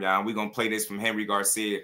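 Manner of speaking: normal talking voice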